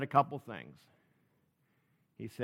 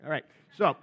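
ordinary talk

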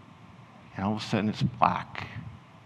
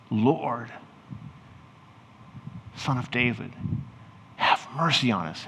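mild pretend cry for mercy; restrained mock scream. Blind man begging Jesus for mercy, restrained calling out. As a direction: call out pleadingly, held well short of screaming. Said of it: "you begin screaming out".

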